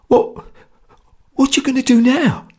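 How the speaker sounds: high, faltering scared voice; effortful, frightened question. A narrator's reading. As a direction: force the question out, very high and anxious. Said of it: "he asked with an effort".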